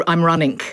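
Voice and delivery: "running" ending in a hard K. Shown as a demonstration. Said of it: "'cause we don't say".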